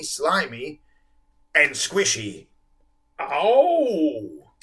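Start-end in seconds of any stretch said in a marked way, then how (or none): 3.2-4.5 a deep bubbling ow from below; a lightly voiced whale. A narrator's reading. Whale crying out from under the children, deep. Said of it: "bubbled a deep voice".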